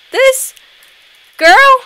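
high, halting and incredulous; indignant disbelief. Real, not acted. Player just called ugly by a girl, high indignant disbelief. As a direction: squeak it in outraged disbelief, rising.

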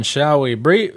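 ordinary delivery, nothing marked

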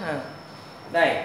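usual speaking voice

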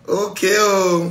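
mock amazement. God slowly exclaiming in mock wonder. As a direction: stretch it out, mock-impressed.

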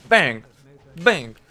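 loud "Bang!" shots; mock gunshot. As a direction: shout it like a gunshot. Imitating gunshots.

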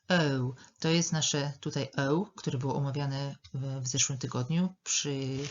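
ordinary talk

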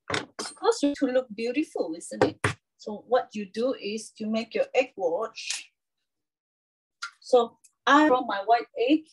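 ordinary delivery

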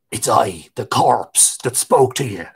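a hint of the dead man speaking; corpse voice. A narrator's reading. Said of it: "said the voice".

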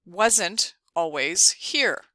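very slow, careful word-by-word reading; slow student reading. Pretend. Teacher as a student repeating the app's prompt slowly. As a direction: read it like a child repeating after the helper.

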